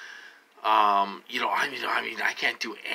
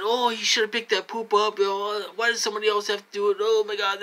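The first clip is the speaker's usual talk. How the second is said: high, whiny mock of the relatives; 10 semitones higher, faster than usual. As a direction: sneer it back in a high complaining voice.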